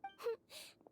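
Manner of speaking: a light, high laugh; short laugh. Acted. Nurse laughing softly at the patient's question, short laugh.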